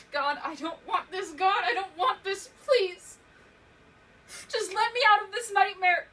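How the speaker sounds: loud, high, escalating cries; desperate pleading to God. Acted. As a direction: beg God, building to a scream. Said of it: "I kept pleading to God".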